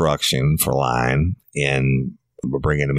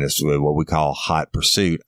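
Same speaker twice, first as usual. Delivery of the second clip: ordinary delivery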